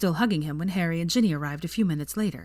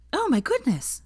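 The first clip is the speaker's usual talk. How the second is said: high, astonished gasp; 12 semitones higher than usual. A narrator's reading. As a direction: gasp it out in delight. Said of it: "she gasped".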